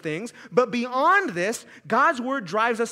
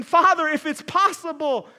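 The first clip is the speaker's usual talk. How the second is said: louder, higher anguished plea; crying out; 7 semitones higher, 8 dB louder than usual. Acted. Jesus in the garden crying out to the Father, anguished. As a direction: cry out to the Father. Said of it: "he cries out".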